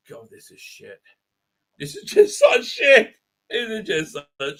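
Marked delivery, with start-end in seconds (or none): 0.1-1.0 very quiet, almost whispered aside; low, barely voiced grumble; hushed disgust. Real, not acted. Critic despairing at the show, hushed disgusted aside. 1.8-3.2 high, loud, slow squawk; exasperated outburst. Real, not acted. Critic fed up with the show, high exasperated yell.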